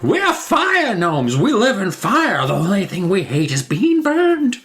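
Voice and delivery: high, drawn-out mock gnome voice. Host mocks gnome logic, high silly voice. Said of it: "that would be like".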